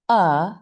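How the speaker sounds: "uh" as a relaxed, neutral vowel; schwa sound. Shown as a demonstration. Teacher showing the second sound of A, schwa sound. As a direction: say "uh" from the throat with a loose jaw. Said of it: "they make a big difference".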